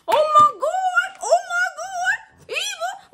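very high, loud, drawn-out laughing scream; high laughing howl of disbelief; laughing shriek. Real, not acted. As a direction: shriek while cracking up. Reader cracks up at herself.

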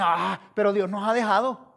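loud, exaggerated wail of abandonment; mock lament. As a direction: imitate people complaining about God.

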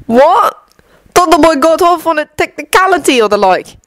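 high, puzzled customer voice; incredulous barfly; surprised pub wizard. Acted.

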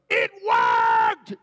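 a high, loud cry of joy; joyful shout. Retold or imitated. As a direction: yell it from the back of the church. Healed woman shouts from back.

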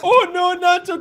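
normal talking voice

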